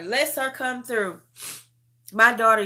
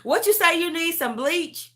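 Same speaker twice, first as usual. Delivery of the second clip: lightly voiced daughter, pitched up; a hint of a teasing daughter; daughter's voice; 9 semitones higher than usual. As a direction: mimic your daughter's higher-pitched question. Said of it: "My daughter will be like".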